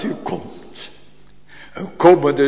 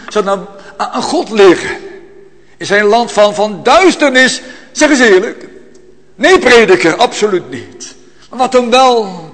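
higher and louder, pleading; rising fervour; 6 semitones higher, 4 dB louder than usual. Acted. Preacher pressing the congregation, climbing into a fervent cry. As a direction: build the questions into a fervent cry.

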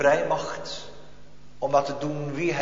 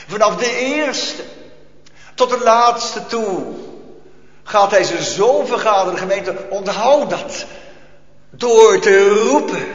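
louder, rising pulpit voice driving the point; full-force preaching; 4 semitones higher, 6 dB louder than usual. Acted. Preacher rousing the congregation, full pulpit force.

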